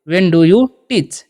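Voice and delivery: very slow, word by word; slow teaching model. Shown as a demonstration. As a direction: model it slowly for students. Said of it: "आप कब पढ़ाते हो?". (बहुत धीरे, साफ़-साफ़ बोलकर)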